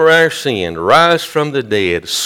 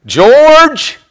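loud, high call of the name; booming, insistent summons; Holy Spirit calling strongly; 8 dB louder than usual. Retold or imitated. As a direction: summon him by name, pitched way up. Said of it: "That voice got so strong".

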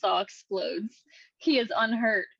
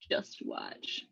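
whispered voiceover; 11 dB quieter than usual. Acted.

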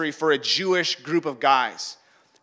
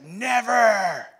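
drawn-out, raised protest; higher-pitched emphatic refusal; vehement denial; 4 semitones higher than usual. Acted. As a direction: swear it with dramatic conviction. Peter's vehement denial to Jesus.

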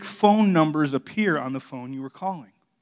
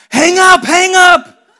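much higher, much louder frantic yell; panicked shout; 15 dB louder, much slower than usual. Retold or imitated. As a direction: scream it in a panic to end the call. Panicked at the confession over speakerphone.

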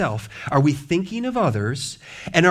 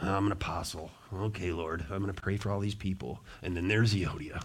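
much softer, grudging aside to the Lord; mock reluctant prayer; 7 semitones lower, 10 dB quieter than usual. Pastor imagining Paul grudgingly praying for quarrelling women, soft and grudging. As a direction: mutter it like a weary duty.

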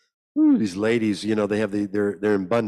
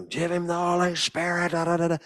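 mock screaming church ladies; 8 semitones higher than usual. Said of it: "they started screaming".

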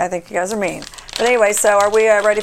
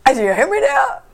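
high, thin mock voice; high squeaky imitation; 11 semitones higher than usual. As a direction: squeak it like a goofy guy. Imitating TikTok guy.

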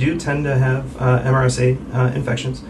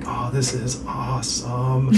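drawn-out, softer slow-mo voice; slow motion; 6 dB quieter, much slower than usual. Retold or imitated. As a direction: imitate a blissed-out patient in slow motion. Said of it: "just going in slow motion".

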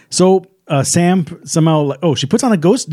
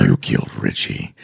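very deep, softer killer voice; Ghostface taunt; 6 dB quieter than usual. Acted. Host imitating the killer accusing someone, Ghostface taunt. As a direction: bottom out into a creaky low accusation. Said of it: "The whole thing with his ghost face was that".

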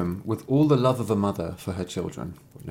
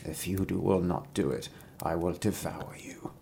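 whispered, low threat; quiet, deadly wolf menace; menacing wolf; 4 semitones lower, 10 dB quieter than usual. Acted. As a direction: hush it right down into a sinister whisper.

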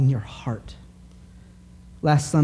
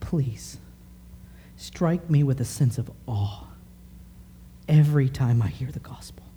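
hushed prayer; 6 dB quieter than usual. Retold or imitated. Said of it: "I thought".

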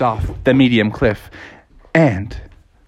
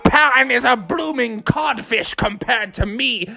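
high, blustering pirate captain; hammed-up villain boast rising to a shout; boastful Captain Hook; 9 semitones higher than usual. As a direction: crow it like a pantomime pirate.

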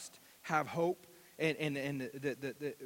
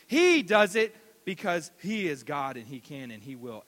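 preaching; 5 semitones higher, 6 dB louder than usual. Acted. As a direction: hammer each promise home. Preaching runs on.

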